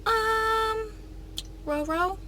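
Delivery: put-on high, sweet child voice; little girl voice. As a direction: sweetly imitate a little niece. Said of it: "She was doing it so sweet".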